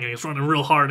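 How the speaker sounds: ordinary talk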